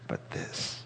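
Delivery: breathy whisper on but this; whispered aside. Pretend. Preacher voicing a hidden reservation, whispered. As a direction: drop to a secret whisper.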